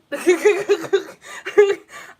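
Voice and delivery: high, loud real laugh; burst of laughter. Real, not acted. Reader baffled by what the cards show, bursting out laughing. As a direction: crack up loudly, high in pitch.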